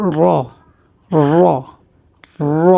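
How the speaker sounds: র with the tongue curled back and rolled; র as a drawn-out Arabic R. Shown as a demonstration. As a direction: demonstrate র slowly, tongue turned up. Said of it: "জিহ্বা উল্টিয়ে যাবে".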